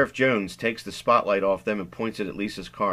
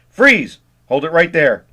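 much higher, much louder command; police shout; 6 semitones higher, 12 dB louder than usual. Acted. Deputy catching kids in the pipe, shouted order. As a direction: stop them cold with a bark.

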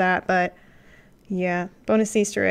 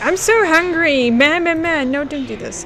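hammed-up starving uncle, high and loud; hungry creepy uncle; 6 semitones higher, 6 dB louder than usual. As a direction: whine it like a starving monster begging. Voicing the uncle character.

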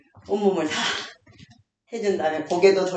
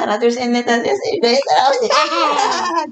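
higher singsong counting; counting for a child; 5 semitones higher than usual. Acted. Grandma counting exercise reps aloud for her toddler. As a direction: count out each rep for the little one.

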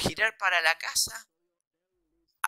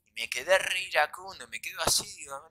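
plain reading voice

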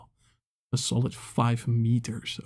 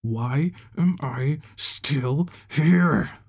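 slow, louder, seething question; furious demand; 3 semitones higher, 5 dB louder, much slower than usual. Acted. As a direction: demand it while shaking him. Leader grabs and shakes acolyte.

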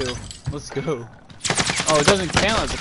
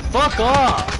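angry yell; 11 semitones higher, 6 dB louder than usual. Real, not acted. Angry outburst at enemy.

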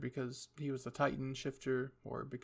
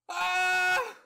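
high, loud scream; 10 dB louder than usual. Acted. Hanji screaming over the Titans, high loud scream. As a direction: scream out at full pitch. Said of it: "Hanji's screams and cries".

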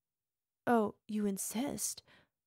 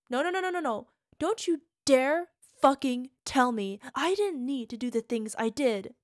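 furious denial; 7 dB louder than usual. Acted. Partner refusing to be told she was wrong, furious. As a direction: refuse it outright, voice rising.

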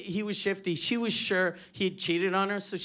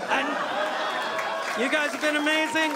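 loud, high thank-you to the crowd; loud show sign-off; 7 semitones higher, 4 dB louder than usual. Real, not acted. Comic thanking the crowd at the show's end, loud sign-off. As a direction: send the audience off big.

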